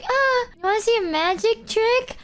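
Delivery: high-pitched, put-on eagerness; mock-chipper high voice; perky distraction. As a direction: offer it brightly to cover awkwardness. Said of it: "I'm just like".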